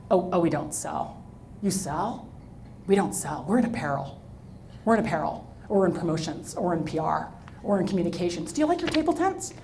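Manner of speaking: low, soft matter-of-fact reply; lightly voiced other women, quiet and plain; women at the reception answering softly; quoted women. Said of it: "women at the reception".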